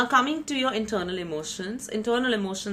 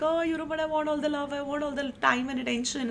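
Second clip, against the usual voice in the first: higher-pitched mock whine for love and attention; whiny wanting partner; 8 semitones higher than usual. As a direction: play a needy partner, pleading and higher. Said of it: "You would be like".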